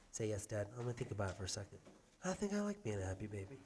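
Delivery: soft, low put-on kid voice; voicing the toddler. Dad voicing his son's reply, soft and low. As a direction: speak for the baby quietly.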